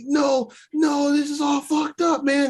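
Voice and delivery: imitated panic, voice jumping way up; high-pitched mock freak-out, refusing help; retold panicked refusal. Retelling Mark's panicked refusal.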